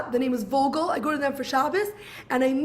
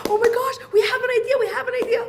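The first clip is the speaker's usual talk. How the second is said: giggly, raised voice, bursting with an idea; excited; 8 semitones higher than usual. Retold or imitated. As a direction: gush it out like a thrilled couple. Couple laughing in the kitchen.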